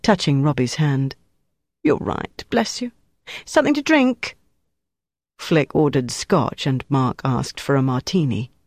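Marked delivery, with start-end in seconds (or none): 1.8-4.4 camp waiter. Acted. Camp waiter voiced in story.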